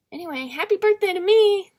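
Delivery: high, loud sing-song outburst; mock cheer. Real, not acted. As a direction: burst out with a forced celebratory cry. Sudden outburst after low musing.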